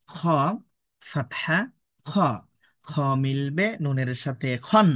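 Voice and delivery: drawn-out chant with rising joined ending; spelling drill recitation. Shown as a demonstration. Teacher models kha joined with noon, drawn-out drill recitation. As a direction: spell it out step by step, stretched.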